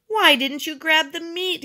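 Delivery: high, loud accusing question; demanding. A narrator's reading. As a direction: demand an explanation crossly. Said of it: "he demanded".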